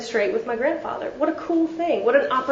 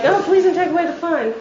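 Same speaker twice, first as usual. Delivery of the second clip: higher, hammed-up plea; mock pleading whine; 6 semitones higher than usual. Speaker mocks her old self begging not to lose fun, whiny. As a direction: beg like a kid losing a toy. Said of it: "I was like".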